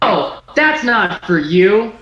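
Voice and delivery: usual speaking voice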